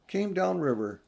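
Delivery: plain reading voice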